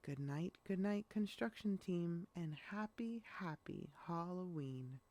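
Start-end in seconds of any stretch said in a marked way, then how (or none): none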